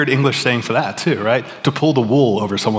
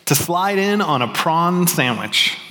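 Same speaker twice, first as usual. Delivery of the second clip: the idiom read slowly and a little higher; savoured slow read; 6 semitones higher, slower than usual. Shown as a demonstration. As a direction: savour each word of the idiom. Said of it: "by far my favorite".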